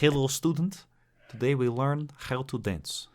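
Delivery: high put-on Russian accent teacher; stern Russian ballet teacher accent. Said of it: "Maybe you should come in with Russian accent".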